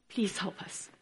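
hushed whisper, pleading; whispered plea. Retold or imitated. Father begging Jesus for his son, whispered. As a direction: breathe the plea softly.

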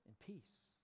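hushed, very slow benediction; gentle blessing. Shown as a demonstration. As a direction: say it tenderly to someone who hurt you. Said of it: "you could say".

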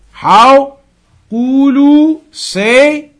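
high and loud How, then say; emphatic question. Real, not acted. Teacher rouses his students with a high, loud How. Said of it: "teacher asks emphatically".

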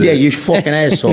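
mock Italian-American tough guy; Italian accent. Chinese New Yorker insulting a doubter, put-on Italian-American accent. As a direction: swear like a New York Italian. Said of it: "you turn Italian".

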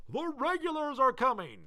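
high ringing alarm call. Acted. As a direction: shout the warning to the town. Paul Revere's famous cry.

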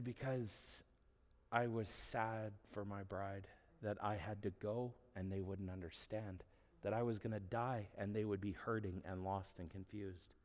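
hushed, softly retold answer of Jesus; quiet, tender sadness for those left behind; gentle sorrow. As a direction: speak softly, with understated grief. Voicing Jesus' sad, tender answer.

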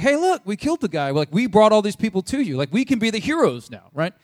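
high, loud pitch for approval; eager boasting. Retold or imitated. Raiders presenting their kill to David, eager boasting. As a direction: play excited would-be heroes showing off.

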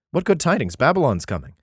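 usual speaking voice

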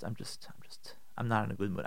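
breathy whisper, barely voiced; whispered admission. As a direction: confess it under your breath. Spouse admitting a bad mood.

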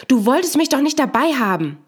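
loud, high angry shout. Acted. Wife shouting at her husband in bed, loud and angry. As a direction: yell it at him, fed up and hurt. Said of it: "Sie schreit".

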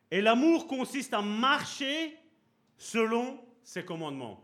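preacher reading the verse to the congregation, slow and lifted; scripture reading. Acted. Reads scripture verse aloud.

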